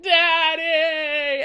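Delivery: gushing son. Retold or imitated. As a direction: draw it out like an overjoyed child. Telemachus embraces his long-lost father.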